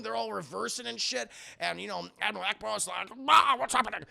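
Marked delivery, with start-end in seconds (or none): 3.3-4.0 high, loud alarmed alien voice; alarmed Ackbar voice. Acted. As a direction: squawk it in alarm.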